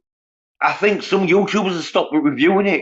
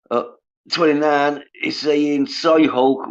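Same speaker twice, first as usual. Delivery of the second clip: ordinary talk, nothing marked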